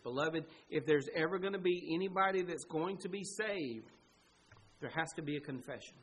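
normal talking voice

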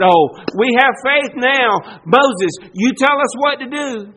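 put-on devotion to Moses; mock eager faith. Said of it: "Is that what happened?".

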